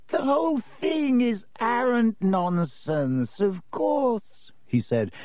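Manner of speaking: put-on high, thin, dry old lecturer; dry reedy voice. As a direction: drone it like a dusty ghost professor. Ghost professor dismisses legend.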